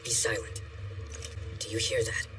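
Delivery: very low, soft commander's voice; hushed menace. Acted. Commander bidding his men listen to battle, hushed menace. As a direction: speak low and hush them.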